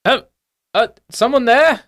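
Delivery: high, jumpy gnome professor; wary high-pitched shout through a door; flustered little voice calling back; startled professor calling out. Acted. Gnome professor calls from behind a door.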